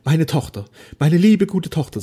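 put-on a proud old man moved to weeping; tearful father. A narrator's reading. Father weeping as he thanks his daughter.

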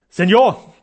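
loud threatening warning. Acted. Madrid surgeon threatens Sternau, loud and menacing. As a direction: warn him menacingly. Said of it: "drohte der Madrider Operateur".

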